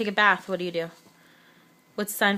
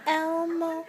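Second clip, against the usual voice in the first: put-on high, softer Elmo for the baby; modeling a word; 7 semitones higher, 4 dB quieter than usual. Shown as a demonstration. Mom models the word Elmo for her toddler, high and sweet. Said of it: "And can you say Elmo?".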